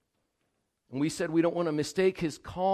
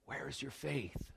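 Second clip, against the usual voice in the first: hushed question; 12 dB quieter than usual. Acted. Jesus rebukes the disciples.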